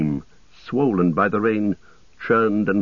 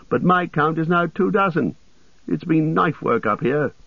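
higher-pitched elf voice; lightly competitive elf; 7 semitones higher than usual. A narrator's reading. Legolas capping the dwarf's count, higher elf voice. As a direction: top a rival's score in a lifted voice. Legolas tops Gimli's score.

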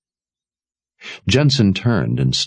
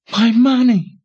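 high, loud cry of panic; panicked cry; 5 dB louder than usual. Acted. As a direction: cry out in despair. Jensen discovers stolen money.

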